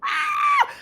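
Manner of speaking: re-enacted inner scream, very high; high-pitched excited squeal. Author screaming inside at being recognised, giddy squeal. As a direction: let out a giddy mock shriek. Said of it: "I said, inside, I was like".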